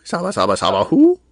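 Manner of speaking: high, loud nonsense comedy voice; goofy gibberish impression. Co-host riffing on the movie gag, loud goofy imitation. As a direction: push the silly character voice up high. Co-host mimics Sandler's garbled voice.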